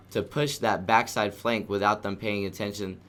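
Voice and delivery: ordinary talk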